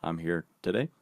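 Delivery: bad Obama impression. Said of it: "here's my really bad Obama".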